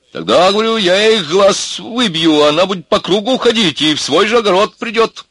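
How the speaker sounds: high, loud rustic rant; aggrieved peasant lad. Acted. Tractor driver tells cellmates of the pig feud, aggrieved. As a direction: pour out the grievance, high and loud.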